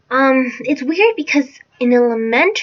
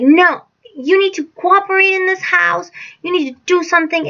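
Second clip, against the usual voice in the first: higher, insistent mock lecture; imitating scolding stepfather; 6 semitones higher than usual. Stepfather demanding help in the house, imitated scolding. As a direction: lay down the law, mimicking.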